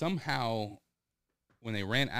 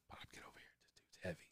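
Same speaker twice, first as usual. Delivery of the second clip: whisper; 3 semitones lower, 21 dB quieter, much faster than usual. Retold or imitated. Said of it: "I said".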